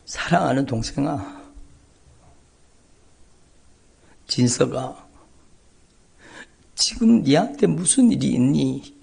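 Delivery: a hint of a tender older sister; sister on the phone. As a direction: gently voice the worried older sister. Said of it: "너머로 들리는 소리가".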